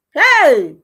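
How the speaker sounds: a sudden, loud, high cry of outrage; loud outcry. Real, not acted. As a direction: burst out in disbelief. Outraged exclamation at the wife.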